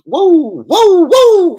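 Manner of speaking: shocked whoa. Real, not acted. Shocked at Balogun sale price.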